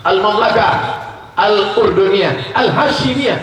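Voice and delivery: Al-Mamlakah Al-Urduniyah Al-Hashimiyah called out loud and high; proclaimed loud. Shown as a demonstration. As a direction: proclaim the kingdom's name like an announcement. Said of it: "karena rajanya keturunan dari Bani Hashim". (diserukan lantang dan tinggi)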